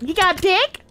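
high squeaky mock voice; mock animatronic. Mocking the animatronic who pulled up, high squeaky voice. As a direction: squeak it like a silly intruder. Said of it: "Man pulled up".

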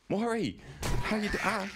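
high-pitched, cheery hail; delighted welcome; excited greeting. Real, not acted. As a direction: greet an arriving friend with pitch jumping up. Greeting arriving viewer.

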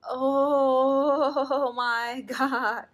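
very slow, loud stretched exclamation; astonished oh my God. Real, not acted. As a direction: hold each word in disbelief. Final big reveal of the strip.

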